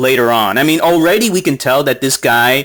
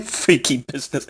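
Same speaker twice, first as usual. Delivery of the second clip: mocking a typo, laughing; 2 semitones lower, 7 dB quieter than usual. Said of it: "It's freaking business".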